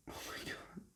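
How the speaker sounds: hushed breathless whisper; soft stunned whisper; awed whisper. Real, not acted. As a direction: whisper it in wonder. Moved by the laptop.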